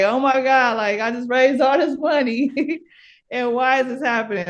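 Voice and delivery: half-laughing replay of her own panic; panicked cry. Retold or imitated. Retelling her panic on floor.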